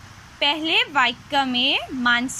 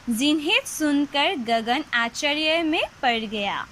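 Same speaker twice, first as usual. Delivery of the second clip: ordinary talk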